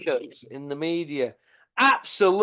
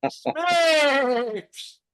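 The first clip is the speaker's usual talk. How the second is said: mock shout; 7 semitones higher, 4 dB louder than usual.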